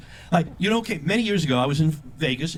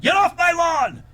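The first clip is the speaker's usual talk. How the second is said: put-on much higher, loud yell at kids; grumpy neighbour shouting at children from the lawn; cranky old man yelling; 13 semitones higher, 4 dB louder than usual. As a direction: bark it at the kids off the porch. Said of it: "I'm snapping at the neighborhood children".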